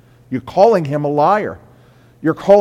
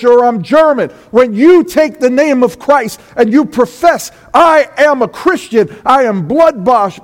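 much higher and louder fiery preaching; full-force preaching; 9 semitones higher, 8 dB louder than usual. Acted. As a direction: drive the warning home to the congregation.

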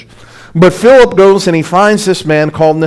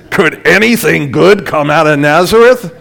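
mock reverent. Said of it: "in his very reverent and religious manner and not judgmental".

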